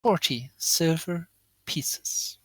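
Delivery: slow, word by word, partly whispered; deliberate repeat. Acted. Tiefling repeats the price when asked how much, slowly and deliberately. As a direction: separate each word for someone who missed it.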